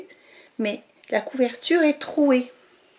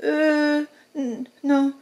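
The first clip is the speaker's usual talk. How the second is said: frightened little mouse; 4 semitones higher, slower than usual. Acted.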